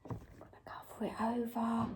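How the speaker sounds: quick, very soft whisper; secretive whisper. Pretend. Presenter hiding toys from children, whispering secretively. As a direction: whisper it as if sneaking.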